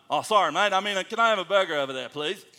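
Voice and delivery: put-on loud, quick Australian-accented request. American re-ordering his burger for the locals, imitated Australian accent. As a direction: switch into a chummy Australian accent. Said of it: "I can do it both ways".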